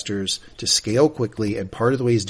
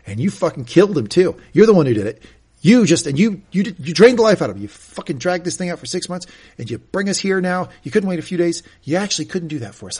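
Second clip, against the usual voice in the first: hostile accusation, acted out louder and higher; Roman; 6 semitones higher, 6 dB louder than usual. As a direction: pile up the blame in a heated retelling. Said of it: "the hostility he's getting from Roman".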